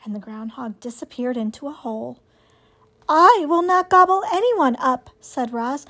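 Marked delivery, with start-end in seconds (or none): 3.1-5.1 high, evenly pitched robot; higher, measured robot heroine. Acted. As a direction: state it as an unemotional robot. Robot reassures the animals.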